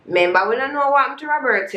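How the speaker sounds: usual speaking voice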